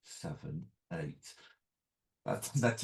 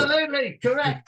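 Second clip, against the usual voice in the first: quiz host; 12 semitones higher, 8 dB louder than usual. Pretend. Mock quiz-show answer reveal.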